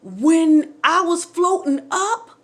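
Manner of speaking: slow, emphatic, building excitement; excited revelation, spelled out slowly; getting excited. Real, not acted. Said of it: "cause I'm getting excited".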